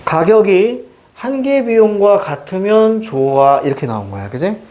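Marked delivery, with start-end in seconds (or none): 0.0-3.6 slow, drawn-out reading with held endings; demonstrated reading. Shown as a demonstration. Teacher shows students how to read the passage, slow demonstrated reading. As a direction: read it out slowly, stretching the key words. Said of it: "어떻게 읽어야 되는 거냐면".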